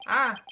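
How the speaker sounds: sudden loud high yelp; startled cry. Acted. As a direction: cry out in shock. Attacker shocked and hurt.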